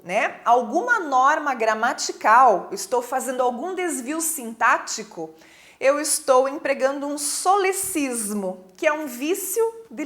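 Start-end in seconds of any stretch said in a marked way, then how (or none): none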